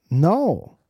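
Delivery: bad Russian accent no; a clumsy stab at Russian. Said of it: "I can't do a Russian accent".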